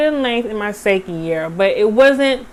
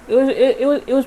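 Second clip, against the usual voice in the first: stuttering; 5 semitones higher, much faster than usual. Real, not acted. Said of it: "I don't know why I'm stuttering so much".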